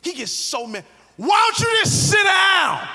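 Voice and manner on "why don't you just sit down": high, loud, slow yell telling him to sit; angry fan shouting sit down. Retold or imitated. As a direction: bellow it at the man in front. Angry fan yells at cheering man.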